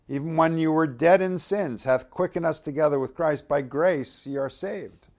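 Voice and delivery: reading the verse aloud to the church, proclaiming; scripture reading. Acted. Reads verse five.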